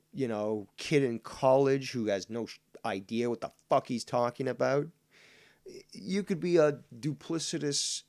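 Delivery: loud heated tirade; angry rant. Real, not acted. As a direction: hammer it home angrily.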